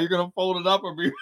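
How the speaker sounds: ordinary delivery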